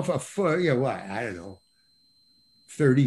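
normal talking voice

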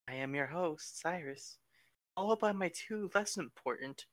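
soft, even automated host voice; robot phone lady. Pretend. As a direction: read it like a polite phone menu. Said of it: "the nice robot lady who's, like, on the phone with you".

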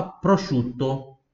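prosciutto drawn out slowly, sh sound for sc, double t held; slow model word. Shown as a demonstration. Teacher asks students to repeat prosciutto, said slowly and clearly. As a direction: spell out prosciutto with the mouth, slowly and cleanly. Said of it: "Repita em voz alta".

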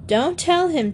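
put-on high, urgent little-boy protest; mock shout from a small boy; child's mild shout. A narrator's reading. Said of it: "Bobby shouted".

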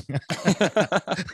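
a real amused laugh. Real, not acted. Laughing at feeling young.

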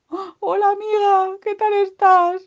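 high, loud hail; hammed-up creature calling hello, drawn out; greeting animal friend. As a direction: call out to a friend. Acted greeting between story animals.

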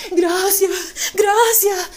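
high, loud, tearful gracias; mock crying thanks. Robbed woman thanking the thief, sobbing loudly. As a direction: blubber thanks through fake tears. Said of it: "Igual parece que estaba llorando".

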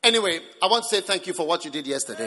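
loud, rushed, perfunctory thank-you; ungrateful thanks. Acted. Grudging person thanking someone for yesterday, loud and brusque. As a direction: fire off the thanks with no gratitude in it. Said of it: "it's not the voice of thanksgiving".

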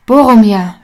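loud sudden call from behind. A narrator's reading. Aragorn calls to his fallen comrade, loud sudden shout. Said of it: "erscholl plötzlich die Stimme von Aragorn".

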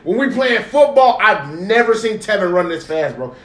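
normal talking voice

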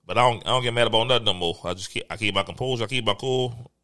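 usual speaking voice

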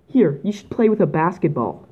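usual speaking voice

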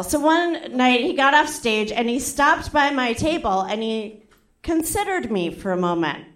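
usual speaking voice

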